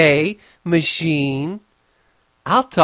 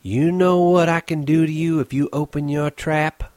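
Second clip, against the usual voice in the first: higher, sneering threat; scornful threat; 7 semitones higher than usual. Acted. Curly's wife threatens Crooks, with scorn. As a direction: taunt him with contempt. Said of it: "in scorn".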